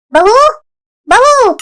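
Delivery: high-pitched summons; calling out. Acted. Mother-in-law summoning her daughter-in-law, calling out. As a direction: call sharply upward for someone in another room.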